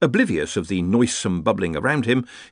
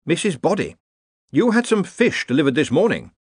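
higher, stern official questioning; 8 semitones higher, 3 dB louder than usual. A narrator's reading. Ponder questions the cook about fish, sternly. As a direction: question her with mild severity. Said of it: "said Ponder sternly".

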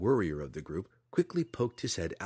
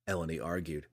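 plain reading voice

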